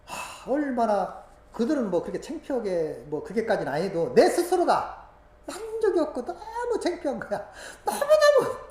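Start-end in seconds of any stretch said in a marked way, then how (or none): 3.7-8.6 higher, slow, tearful laugh; real tearful laugh; laugh-crying. Real, not acted. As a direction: confess shame through tears and laughter.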